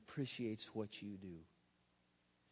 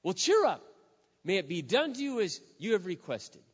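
dismissive priest; 7 semitones higher, 8 dB louder than usual. Retold or imitated. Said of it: "And then he goes".

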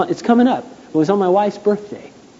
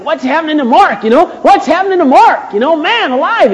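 mock amazed onlookers; 11 semitones higher, 4 dB louder than usual. People marveling at him.

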